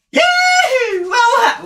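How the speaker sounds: high, loud hillbilly holler that cracks. Acted. Trying a hillbilly line for the camera, voice cracking. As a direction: whoop it out until the voice breaks. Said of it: "Had a little voice crack there".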